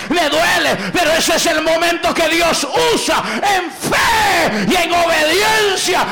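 shouted, high-pitched sermon with aleluyas; fiery Pentecostal preacher crying out to the congregation; full-force preaching. Acted. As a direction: preach it out loud like a revival pastor.

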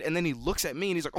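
usual speaking voice

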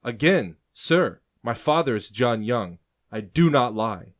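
loud, slow and firm. A narrator's reading. Nephew insisting to his uncle, slow and firm. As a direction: plant every word, no doubt. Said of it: "Adahy says firmly".

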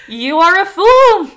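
loud, high, slow shout; big drawn-out fox shout; storybook shout. A narrator's reading. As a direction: shout it out, drawing out each word. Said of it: "shouted the fox a third time".

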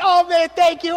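imitating an overjoyed woman; big excited thanks. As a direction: burst out thanking like a big personality. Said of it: "She had a big personality".

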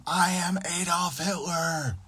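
hammed-up boss ranting, pitched high; mock scream. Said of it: "and he'd scream".

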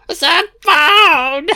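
high, loud squeal drawn out; hammed-up, high-pitched gleeful yell; manic cry. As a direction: shriek it in delighted hysterics. Gleefully mimicking a film line.

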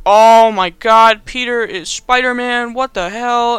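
loud, drawn-out mock outrage; slow hammed-up angry outburst; Norman, really mad. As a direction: act out shocked anger, starting loud and stretched. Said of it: "Norman gets really mad".

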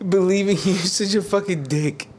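excited disbelief. Real, not acted. Realizes friend was joking.

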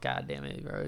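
low, soft grumble under the breath; muttered dismay. Retold or imitated. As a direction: curse privately at the host's words. Inner cursing at the intro.

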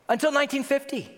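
high-pitched, appalled repeat; can't-believe-it outburst; incredulous. Real, not acted. Repeats the date in disbelief.

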